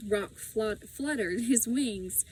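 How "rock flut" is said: ordinary delivery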